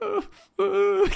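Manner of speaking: very high whimpering uh uh; very high mock whimper. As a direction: imitate a choked-up sob. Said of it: "where I was like".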